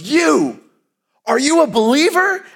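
high, loud challenging shout; calling across the street. Retold or imitated. Big man shouting at the students from across the street. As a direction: bellow the question across four lanes.

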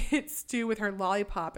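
ordinary talk, nothing marked

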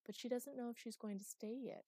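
high, teasing lilt. A narrator's reading. Master to fairy about the newcomer, teasing. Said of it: "she said teasingly".

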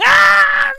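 high, loud fan scream, imitated; mock crowd scream. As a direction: ham up a crowd of screaming fans. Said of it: "motherfuckers were screaming".

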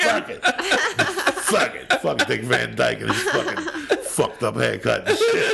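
mock-dismissive laughing rant. Real, not acted. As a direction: spit it out while laughing. Laughing rant about old idol.

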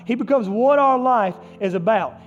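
fervent pulpit delivery; impassioned preaching. Real, not acted. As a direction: preach it high and strong, punching key words. Preaches at full force.

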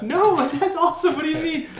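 high-pitched, laughing defence of dad; mock protest. Acting out his younger self protesting.

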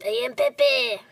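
lightly voiced Muppet prawn; a quick hint of Pepe; Pepe the King Prawn. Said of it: "Can you give us a little impersonation".

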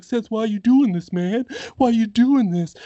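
high, mildly pleading retelling; mock tearful plea. Retelling Bray begging Seth to stop, mock tearful. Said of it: "He's got tears in his eyes".

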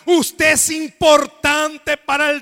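loud, rousing sermon delivery; preaching at full force. Acted. Pastor rousing the congregation, preaching loud and full force. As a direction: preach it out to the whole church.

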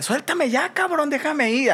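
high pleading cry of a woman being grabbed; struggling woman. Retold or imitated. Woman pulling free from the man, high desperate plea. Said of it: "la morra le decía como de".

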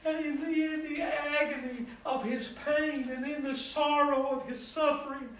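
high, loud, fervent preaching cadence; impassioned preaching. Acted. Preacher to congregation, high loud fervent preaching. Preacher rising to full force.

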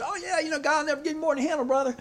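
high, fast mock church-folk voice; mocking churchgoer. Preacher mimicking a well-meaning churchgoer's cliché, high and glib. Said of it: "people, even well-meaning Christians and churchgoers".

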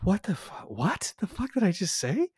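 high-pitched mock bewilderment; baffled disbelief. Cousin startled by his own swearing, baffled. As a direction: question your own mouth in disbelief.

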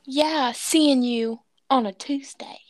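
slow, husky whispered banter; silly whispery character voice; put-on whispery voice. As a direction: draw it out in a playful stage whisper. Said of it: "I'm gonna stop talking like that".

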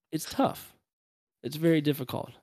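usual speaking voice

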